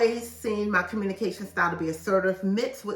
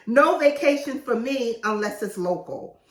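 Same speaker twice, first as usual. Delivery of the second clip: emphatic no; 4 semitones higher, 6 dB louder than usual. Pretend. Listing no's emphatically.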